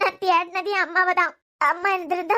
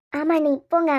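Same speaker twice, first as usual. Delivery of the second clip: usual speaking voice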